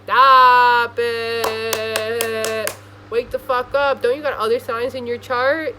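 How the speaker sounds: loud, chanted then yelled scold; sing-song shout of frustration; exasperated scolding. Retold or imitated. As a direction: nag the earth sign awake. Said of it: "I'm like".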